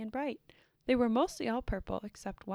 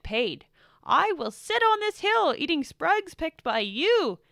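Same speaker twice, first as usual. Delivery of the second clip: higher, much louder, bossy rant; angry shouting; 6 semitones higher, 11 dB louder than usual. A narrator's reading. Blue shouts orders at the purples from his chair. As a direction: lord it over them, shouting. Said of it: "shouted Blue".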